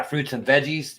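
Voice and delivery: usual speaking voice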